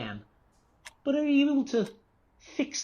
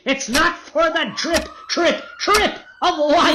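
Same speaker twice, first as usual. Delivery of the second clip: unhinged singsong; 11 semitones higher than usual. Acted. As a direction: drift into a deranged chant. Patient rambling unhinged.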